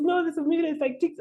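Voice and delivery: very high-pitched mock exasperation; exasperated correction. Host correcting newcomer about the meter, high and exasperated. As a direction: imitate the host insisting, voice way up.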